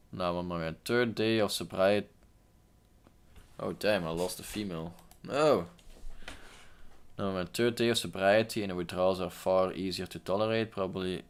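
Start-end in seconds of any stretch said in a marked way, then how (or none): 5.2-5.7 sudden loud dismayed no; dismayed cry. Real, not acted. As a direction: yelp at a loss in game. Lost a female in game.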